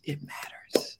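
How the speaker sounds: soft breathy whisper, almost to herself. Real, not acted. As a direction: drop to a hushed whisper. Emotional repeated affirmation.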